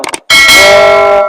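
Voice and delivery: تا as a loud, long, thick "taw" like طا; heavy emphatic ta. Shown as a demonstration. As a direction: round and hold the vowel of تا heavily. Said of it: "طا کی آواز".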